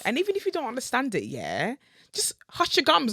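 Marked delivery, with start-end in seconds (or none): 2.1-3.1 loud, high scolding snap; mock-stern shushing; hush your gums. Real, not acted. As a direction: tell them to shut it, sharp and loud. Telling homophobes to shut up.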